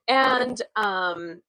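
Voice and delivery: usual speaking voice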